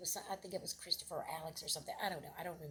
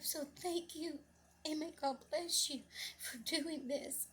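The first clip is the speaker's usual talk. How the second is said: high, emotional blessing; tearful gratitude; 8 semitones higher, slower than usual. Retold or imitated. Mother thanking lady for bringing son, tearful. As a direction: bless them through welling tears.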